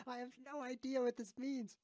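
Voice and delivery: very high squeal through laughter; cracking up. Real, not acted. Streamer baffled by his own nonsense, cracking up. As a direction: squeeze the words out while laughing. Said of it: "can't even say it with a straight face".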